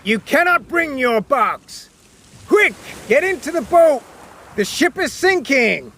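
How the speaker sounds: high, urgent shouted orders; captain orders Cornelius into the boat, shouting. Acted. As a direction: press him hard to get in. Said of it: "shouted the captain".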